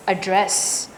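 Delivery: "Address" with a weak schwa, then stressed "dress". Shown as a demonstration. Teacher correcting a student's stress, "Address" stressed on DRESS. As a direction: lean on the second syllable of "Address". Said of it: "the stress is on dress".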